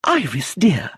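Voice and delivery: high, prim chattering lady's voice; a high, fussy society widow rattling on; fussy old lady. Acted. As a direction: play her as a prim, talkative elderly aunt. Lucilla greets her niece.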